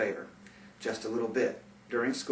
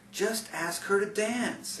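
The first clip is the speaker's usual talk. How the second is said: put-on higher, slower friendly girl voice; Angela; 8 semitones higher, slower than usual. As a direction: lightly voice a gentle female friend.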